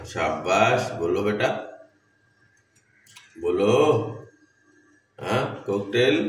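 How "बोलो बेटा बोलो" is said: ordinary delivery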